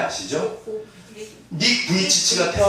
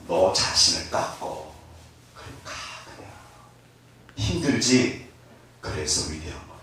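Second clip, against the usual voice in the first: gentle divine counsel; 9 semitones lower, 6 dB quieter than usual. Acted. Voicing God's counsel.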